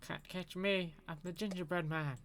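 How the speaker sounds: gingerbread man voice. Retold or imitated. Reciting the gingerbread man clip.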